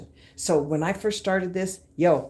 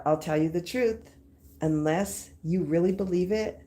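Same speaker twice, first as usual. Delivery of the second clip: usual speaking voice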